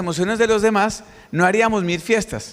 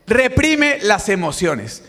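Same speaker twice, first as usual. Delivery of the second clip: slow emphatic repeat; much slower than usual. Real, not acted. Speaker repeats answer emphatically.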